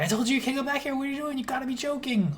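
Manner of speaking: high, panicked receptionist voice; frantic receptionist. Retold or imitated. Receptionist chasing an intruder, high and frantic. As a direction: squeal it like a receptionist losing control. Receptionist bursting in after Nia.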